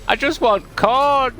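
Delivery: put-on high, loud, slow little-kid voice; corn kid. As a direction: pipe it up like an earnest small boy.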